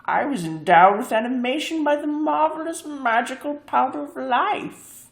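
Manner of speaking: prim high reply, slowing at the end; glass cat voice. A narrator's reading. Glass cat answering the leader, high and prim. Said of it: "but replied".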